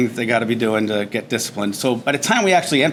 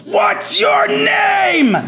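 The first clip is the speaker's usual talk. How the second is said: much higher, drawn-out bellow of a question; drill instructor scream; 5 dB louder, much slower than usual. Retold or imitated. Drill instructor screams at the new recruit, very loud and high. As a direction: roar it like a drill instructor on day one. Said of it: "Drill instructor screaming at me".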